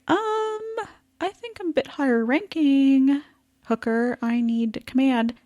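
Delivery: hesitant, high-pitched hint at rank; pointed self-promotion. Retold or imitated. Howard angling to Hooker for a command, pointedly.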